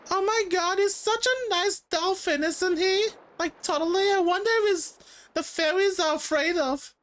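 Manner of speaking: put-on very high falsetto girl voice; put-on high girly voice; gushing girl character. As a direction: gush in an exaggerated high falsetto. Voices the chicks in game.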